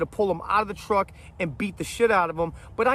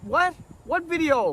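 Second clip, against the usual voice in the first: mock surprise; 9 semitones higher than usual. Feigning ignorance.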